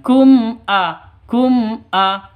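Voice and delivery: crisp m in KUM, then A without humming; clear m reading. Shown as a demonstration. Teacher drilling the right reading, clear KUM A. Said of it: "Cara bacanya".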